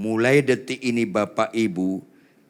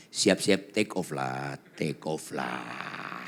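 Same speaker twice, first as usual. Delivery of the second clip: fading out; 10 semitones lower, 6 dB quieter, slower than usual. Retold or imitated. Mock dying farewell, fading.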